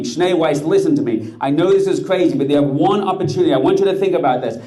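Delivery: high, loud desperate pleading; pleading scream. Acted. Rebbe screaming his plea at the officer, high and loud. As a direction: scream at him to listen. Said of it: "pleading, screaming".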